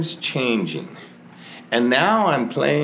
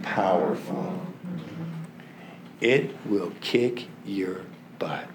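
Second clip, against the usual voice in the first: usual speaking voice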